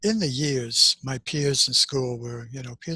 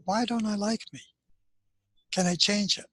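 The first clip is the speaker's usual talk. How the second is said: higher, wondering self-question; inner questioning voice; 8 semitones higher than usual. Retold or imitated. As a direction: ask yourself gently, searching inside. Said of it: "find out".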